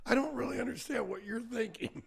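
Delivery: can't follow their logic; baffled retort. Retold or imitated. Telling the bank he can't follow them, baffled. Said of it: "I'm like".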